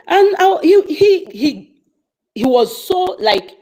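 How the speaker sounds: ordinary talk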